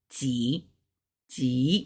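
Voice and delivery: "T" dipping low in a V shape; third tone. Shown as a demonstration. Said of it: "'cause it's a third tone 'cause it got that V shape".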